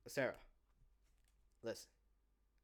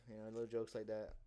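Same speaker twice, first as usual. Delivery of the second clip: usual speaking voice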